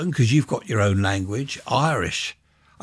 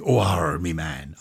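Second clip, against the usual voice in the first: mock West Country; 5 semitones lower than usual. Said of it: "And the West Country".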